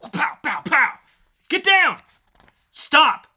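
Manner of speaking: hammed-up gunfight yelling; acted gunfight. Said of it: "dramatic reading".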